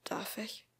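soft, tentative question; hushed, careful request; quietly asking. A narrator's reading. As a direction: ask it under your breath. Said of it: "fragte er leise".

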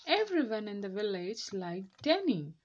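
ordinary delivery, nothing marked

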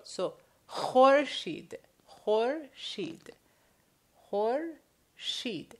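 khorshid with a harsh throaty kh, slow; throat-clearing kh. Shown as a demonstration. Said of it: "as if you're trying to clear your throat".

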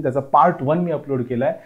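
ordinary delivery

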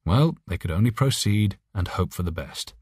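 usual speaking voice

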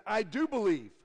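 high, loud cry of belief; a father crying out to Jesus, high and loud; cried out. Acted. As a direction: cry it out desperately. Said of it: "cried out".

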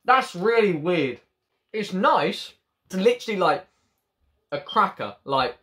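talking while chewing; speaking behind hand; mouth partly full. Real, not acted. Seen 2: Chews after a bite, hand covering mouth while speaking early on.

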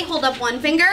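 usual speaking voice

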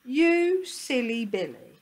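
slow, high, loud chiding; singsong mock telling-off; teasing dog. A narrator's reading. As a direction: draw it out in playful scorn. Another dog teases Willie.

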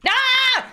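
high, sudden scream; quick high shriek of surprise; startled yelp. Real, not acted. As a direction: yelp in alarm. Startled during getaway.

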